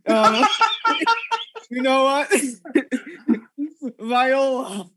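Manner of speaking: high, breaking into laughter; can barely speak for laughing. Real, not acted.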